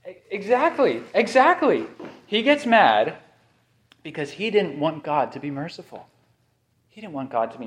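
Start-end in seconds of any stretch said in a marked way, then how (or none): 0.1-1.9 emphatic exactly. Real, not acted. Emphatic agreement, rising.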